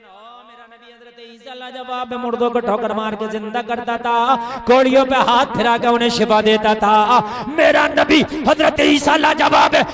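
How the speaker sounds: chanted boast building to a loud shout. Acted. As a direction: act out a challenger's rising proclamation. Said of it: "ईसाई पकड़ सकता है गिरबान". (विरोधी की बढ़ती हुई ललकार)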